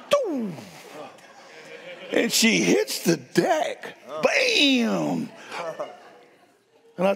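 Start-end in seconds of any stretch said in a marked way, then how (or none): 0.1-0.5 tossing sound effect. Retold or imitated. Imitating the toss. 4.2-5.3 impact sound effect. Retold or imitated. Imitating the impact.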